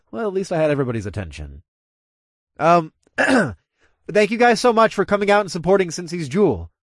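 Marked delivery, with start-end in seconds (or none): 2.6-6.7 loud, raised crowd-address voice; announcing. A narrator's reading. Club owner thanking guests on the microphone, announcing. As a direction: clear your throat and project to the room. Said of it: "I announced".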